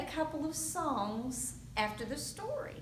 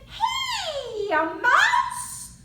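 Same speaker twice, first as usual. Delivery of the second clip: hare voice; 10 semitones higher, 10 dB louder than usual. Acted. Hare greets mouse in story.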